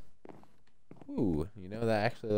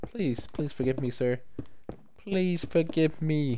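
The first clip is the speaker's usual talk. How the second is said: mock pleading; 5 semitones higher, 3 dB quieter than usual.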